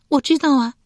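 high, loud wifely reply; wife's voice. A narrator's reading. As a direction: answer eagerly as the wife. Wife answers husband's question.

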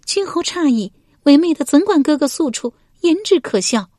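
high female character voice, protesting; high-pitched, embarrassed protest; flustered denial. A narrator's reading. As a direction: brush it off in a high lady's voice. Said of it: "文姜不觉面赤道".

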